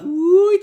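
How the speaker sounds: loud, high mock "woo"; high whooping noise imitating someone shrinking away.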